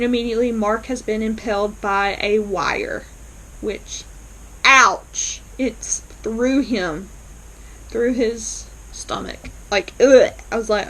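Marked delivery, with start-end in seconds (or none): 4.6-5.4 pained ouch. Real, not acted. Reacting to gory impalement. 9.7-10.3 loud disgusted ugh. Real, not acted. Disgusted at the wound.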